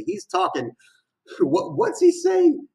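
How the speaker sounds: high, delighted squeal; hyped up by the lyrics; excited. Real, not acted. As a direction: jump way up in pitch with glee.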